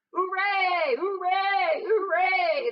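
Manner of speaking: loud triumphant hooray, high; rowdy cheering. Acted. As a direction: whoop it up like a drunken crowd. Weasels cheering at banquet.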